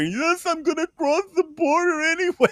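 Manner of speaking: high, silly defiant voice; mock defiant activist. Podcaster mocks an activist defying border guards, high and silly. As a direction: sneer it in a high put-on voice.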